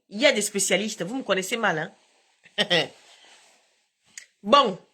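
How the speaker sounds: ordinary talk, nothing marked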